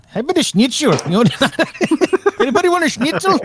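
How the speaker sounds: hammed-up, high German accent; goofy German accent, laughing through it. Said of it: "we're still waiting for your German Polish accent".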